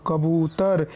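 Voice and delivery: कबूतर run together slowly with ū held; blended, long ū. Shown as a demonstration. Teacher showing how to join letters, कबूतर with a long ū. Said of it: "इस तरीके से मिला करके पढ़ेंगे".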